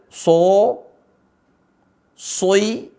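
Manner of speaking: "so. Soi." with lips rounding into o; wrong rounded sad. Shown as a demonstration. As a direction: add a w-like glide in "Soi". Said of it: "Bukan dibaca dengan".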